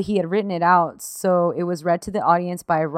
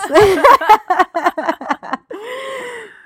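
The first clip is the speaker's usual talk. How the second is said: teary laugh; 13 semitones higher, 8 dB louder than usual. Real, not acted. Laughing after crying.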